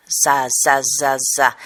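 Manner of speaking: za repeated with a buzzing English z; forced voiced S. Shown as a demonstration. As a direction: exaggerate the voiced s in each za. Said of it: "Lo estoy forzando".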